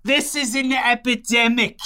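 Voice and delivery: high, slow Staten Island outcry; mock-alarmed resident in a broad Staten Island accent; hammed-up Staten Island voice; Staten Island woman. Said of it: "the woman from Staten Island".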